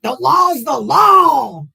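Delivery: slow, loud mocking bellow; hammed-up law-and-order yell; mock maga shout. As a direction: shout it like a self-righteous voter. Parroting maga talking point.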